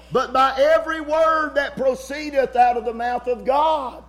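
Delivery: loud, high scripture cry; preaching shout. Real, not acted. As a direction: thunder the verse out. Preacher quoting scripture at full force.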